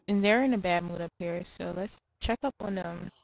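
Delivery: ordinary talk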